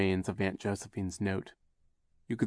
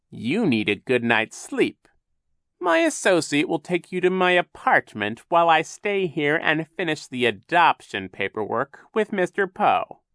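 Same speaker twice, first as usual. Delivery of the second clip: loud, higher-pitched villain; Captain Sham, falsely soothing; 8 semitones higher, 9 dB louder than usual. A narrator's reading. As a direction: send them off with false concern. Captain Sham dismisses the children.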